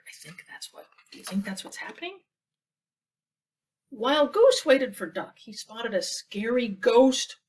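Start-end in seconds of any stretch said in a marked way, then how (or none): none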